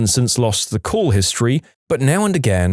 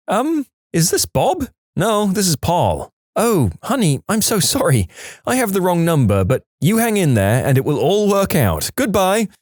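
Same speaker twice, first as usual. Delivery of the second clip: apologetic woman caller; 7 semitones higher than usual. Acted. Woman realises wrong number, apologises kindly.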